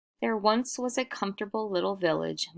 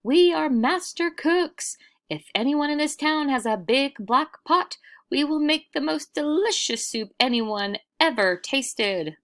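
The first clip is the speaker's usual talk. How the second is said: shouting; 9 semitones higher, 5 dB louder than usual. Acted. Travelers shouting to the village from the well, loud high proclamation. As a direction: call out to the whole town. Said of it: "and shouted".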